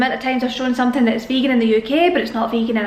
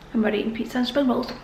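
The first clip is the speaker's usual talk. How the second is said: ordinary talk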